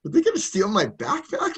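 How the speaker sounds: half-laughing, mock-worried question; laughing worry. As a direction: laugh through a playful suspicious question. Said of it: "I was a little bit like".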